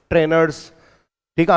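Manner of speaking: ordinary delivery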